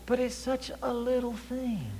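slow, high, soft lament; mock pained whimper. Preacher acting out sitting sweating with a stubbed toe, pained lament. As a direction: squeeze it out softly, as if nursing the hurt. Acted thought while nursing toe.

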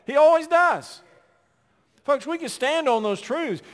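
high, loud ringing declaration; fervent preacher's cry; emphatic shout. Real, not acted. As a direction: proclaim it to the back pew.

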